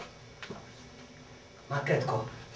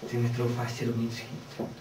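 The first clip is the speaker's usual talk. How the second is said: usual speaking voice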